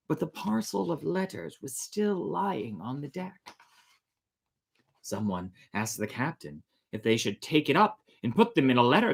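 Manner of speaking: put-on steady storyteller reading an old sea tale; story narration. A narrator's reading. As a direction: tell the legend evenly to listeners. Reads sea legend aloud.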